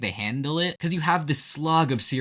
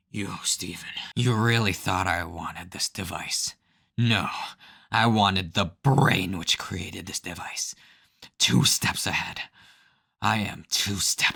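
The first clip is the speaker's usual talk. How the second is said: lower, slow, smug villain voice; Light Yagami; 4 semitones lower, slower than usual. Retold or imitated. Villain Enoch gloating at Stephen, in a low, slow, smug voice. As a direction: deliver it like a scheming anime mastermind. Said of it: "talking like he was Light Yagami".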